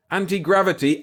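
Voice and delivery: anti-gravity shouted high and loud; excited shout. Acted. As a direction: call out anti-gravity in thrilled disbelief. Calls out discovery excitedly.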